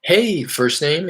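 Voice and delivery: drawn-out, high "Hey, first name"; slow demo greeting. Shown as a demonstration. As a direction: show how to open "Hey, first name" slowly.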